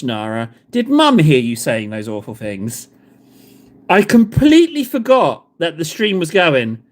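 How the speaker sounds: usual speaking voice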